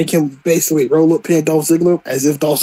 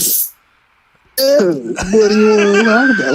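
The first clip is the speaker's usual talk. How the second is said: slow, smug, laughing mock question; snarky; 3 semitones higher, slower than usual. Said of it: "it's so snarky".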